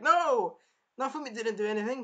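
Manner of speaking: high, fast protest; urgent defense. Acted. Raphtalia protesting to the king, high and hurried. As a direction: jump in to defend him.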